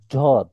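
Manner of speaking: ঝদ with a heavy z sliding toward d; heavy dwad. Shown as a demonstration. Teacher showing the special Arabic dwad to a student, heavy. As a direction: make ঝদ heavy, z leaning into d. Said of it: "ঝকে একটু ভারী করে দয়ের দিকে".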